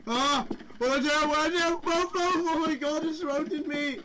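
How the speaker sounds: high, loud panicked shouting. Real, not acted. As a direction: panic while surrounded. Surrounded by monsters.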